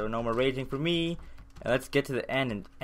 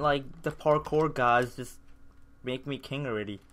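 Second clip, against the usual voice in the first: usual speaking voice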